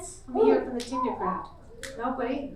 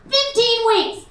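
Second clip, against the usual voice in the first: loud, high-pitched incredulous rant; comic outraged yell; exasperated shouting; 7 semitones higher, 9 dB louder than usual. Acted. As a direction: shout it as if it's obvious. Emphatic shouted repeat in a rant.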